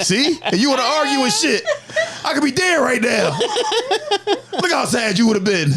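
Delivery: high, laughing, near-crying shouted quote; laughing retelling. Retold or imitated. Retelling what he told his wife after the fake shooting, high laughing half-shout. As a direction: cackle through the words you told her. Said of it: "That's my exact words. I said".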